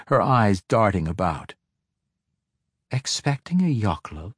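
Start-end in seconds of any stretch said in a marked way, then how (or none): 2.9-4.3 sly, softer taunt; teasing. A narrator's reading. Girl taunts the uneasy matron mother, sly soft tease. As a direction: needle her playfully. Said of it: "Ivanel teased".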